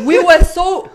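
words pushed out through a laugh; giggling start. Real, not acted. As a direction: laugh as you start the line. Laughing while recalling nerves.

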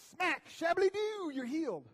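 high, loud, slow hammed-up faith healer; mock faith healer. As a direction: belt it out like a TV healer slapping a forehead.

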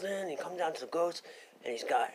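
ordinary delivery

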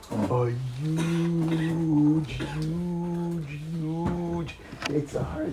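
low, soft, very slow huge; drawn-out exaggeration. Teacher inflating the conquered enemy, slow mock awe. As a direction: stretch each huge into a long low drone. Mocking size of the conquered foe.

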